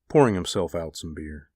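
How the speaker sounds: ordinary delivery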